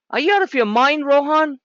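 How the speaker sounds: half-laughing can't-believe-it challenge; incredulous outburst. Real, not acted. Scolding a student's lowball guess.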